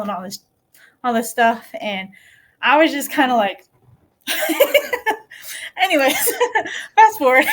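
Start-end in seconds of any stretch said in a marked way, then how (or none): 5.8-7.4 giggling, louder through the words; laughing. Real, not acted. Brushing past the cancer story, laughing. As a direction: laugh it off and skip ahead.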